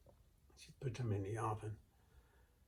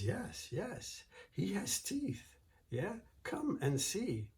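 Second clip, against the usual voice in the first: high, sugary, innocent voice; sweet innocent wife; 9 semitones higher than usual. Acted. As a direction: play it sweet and harmless to fool him. Wife luring the giant.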